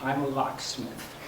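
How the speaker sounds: soft, understated quoted reveal; quietly imitating a soft-spoken stranger. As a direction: drop the volume and say it simply. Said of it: "he says quietly".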